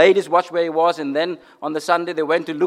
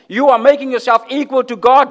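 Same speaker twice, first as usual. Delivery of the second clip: higher and louder indignant accusation; accusing; 7 semitones higher, 5 dB louder than usual. Acted. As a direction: hurl the charge of blasphemy. Pharisees' accusation acted out.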